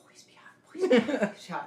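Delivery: hushed, pleading whisper; whispered plea. Retold or imitated. Man peeking under covers at the girl, whispered plea. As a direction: whisper it like a desperate little prayer. Silent wish before lifting the covers.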